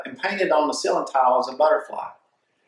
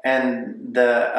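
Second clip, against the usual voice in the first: ordinary talk, nothing marked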